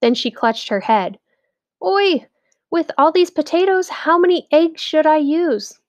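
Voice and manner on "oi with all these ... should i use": high, worried wife's voice. A narrator's reading. Wife panicking over how many eggs, high and worried. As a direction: clutch your head and fret.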